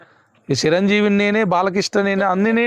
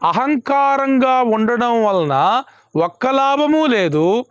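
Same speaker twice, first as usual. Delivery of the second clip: slow emphatic preaching; 3 semitones higher, much slower than usual. Real, not acted. Preacher stressing point slowly.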